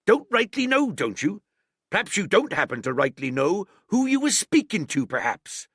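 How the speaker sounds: high, loud sneering echo; mocking scorn. A narrator's reading. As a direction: throw his words back at him. Silver mocking Morgan's answer.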